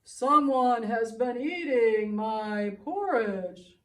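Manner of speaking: loud, slow Papa Bear voice; a big bear rumbling his complaint. Acted. Papa Bear loudly complains about his eaten porridge. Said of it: "and Papa Bear said".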